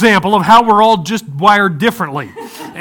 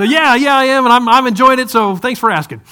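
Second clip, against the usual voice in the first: cheerful; 6 semitones higher, 5 dB louder than usual. Retold or imitated.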